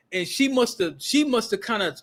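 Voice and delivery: normal talking voice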